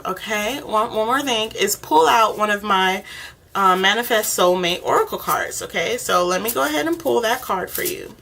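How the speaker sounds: ordinary talk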